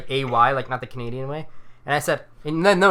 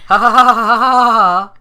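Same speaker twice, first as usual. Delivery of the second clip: mock laugh; 5 semitones higher, 9 dB louder than usual. Reading his typed laugh aloud.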